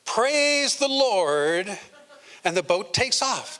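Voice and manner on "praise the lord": Praise the Lord. Retold or imitated. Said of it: "He had said".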